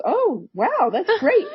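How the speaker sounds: high, breezy mock delight; imitating an unfazed, pleased mom; delighted mother. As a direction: react as if it's wonderful news. Mother retold as delighted by ghosts.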